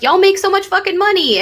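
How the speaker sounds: voiced high and pointed, mocking people's envy; mock envious jab. Friends who assume she's rich, mock-envious jab. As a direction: fling it like a jealous accusation. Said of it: "people are like".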